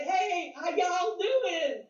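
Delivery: high, slow, drawn-out Southern friendly hello; Southern boy greeting. Retold or imitated. Soldier greets the speaker on arrival, slow high Southern hello. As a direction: stretch it out like a cheerful Southern boy. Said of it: "a big Southern boy came in".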